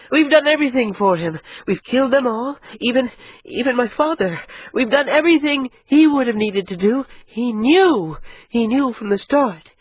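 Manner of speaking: high, urgent woman's voice, rising panic; Ida'an, alarmed. Acted. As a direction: she sees the trap and can't stop saying it. Ida'an realizing the trap.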